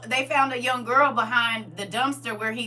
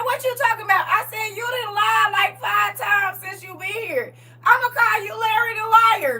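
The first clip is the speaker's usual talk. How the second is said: loud call-out; 12 semitones higher, 6 dB louder than usual. Retold or imitated. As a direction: call him out to his face, loud and high. Calling out the lying man.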